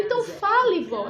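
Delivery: eager urging. Real, not acted. Grandmother urging the child to speak, laughing and excited. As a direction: urge her on, laughing.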